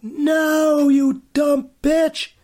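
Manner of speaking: high, loud, drawn-out yell; shouting at the screen. Acted. Viewer yelling at the movie's heroine, exasperated shout. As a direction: holler it at the character, stretching the words. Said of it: "you're supposed to shout at the".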